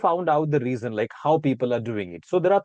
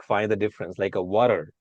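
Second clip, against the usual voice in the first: ordinary talk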